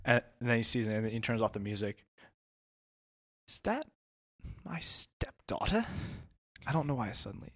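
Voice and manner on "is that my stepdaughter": put-on high, slow, softer British accent; accidental British. Stepdad spots the kidnapping, suddenly British. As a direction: slip into British, drawing out the question. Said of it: "that was British".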